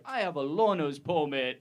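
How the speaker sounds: R's lost in "learner's permit", W-like instead; R speech impediment. Retold or imitated. Grown-up kid announcing his permit, "learner's permit" with W-like R's. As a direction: say "learner's permit" unable to pronounce an R. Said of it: "I couldn't say words with R's in them".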